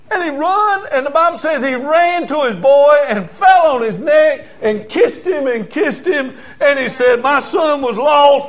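high, loud, stretched-out jubilant delivery; preacher acting an overjoyed father at full force; exultant preaching. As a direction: call out orders for a celebration. Preaches the father's joyful welcome.

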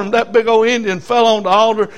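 normal talking voice

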